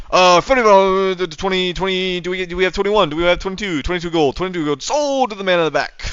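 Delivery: high fast auction patter; auctioneer voice. Shown as a demonstration. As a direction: call the bids like an auctioneer. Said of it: "I'll do it like an auctioneer".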